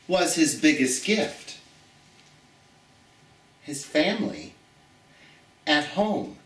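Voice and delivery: put-on gentle read-aloud storytelling for children; story reading. Teacher reading a picture book aloud to her students, storytelling.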